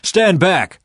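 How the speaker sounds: loud, high bark of a command; threatening shout at the door; angry shout. A narrator's reading. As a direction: shove the words at him. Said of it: "they shouted".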